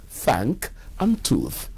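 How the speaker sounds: "thank, and tooth" with a soft th; correct th. Shown as a demonstration. Teacher models the correct English th, soft and quiet. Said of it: "the correct thing".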